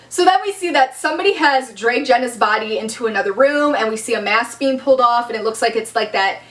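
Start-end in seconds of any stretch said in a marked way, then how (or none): none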